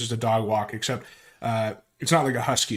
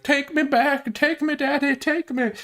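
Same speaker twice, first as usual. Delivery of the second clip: much higher, whiny begging voice; mock groveling whine; slower than usual. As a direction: beg like a needy sycophant. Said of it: "a groveling email being like".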